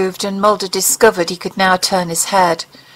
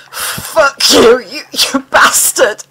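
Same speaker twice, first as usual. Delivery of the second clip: a higher, straining curse that catches in the throat; choked curse; 5 semitones higher, slower than usual. A narrator's reading. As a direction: spit it out while choking up. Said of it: "His voice choked him".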